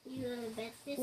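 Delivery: low, very soft repeat; hushed. Real, not acted. Sister repeating her compliment in the game, hushed. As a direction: drop right down, almost whispering. Whispering game, said again quietly.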